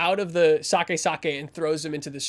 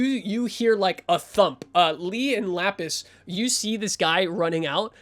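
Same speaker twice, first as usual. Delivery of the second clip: normal talking voice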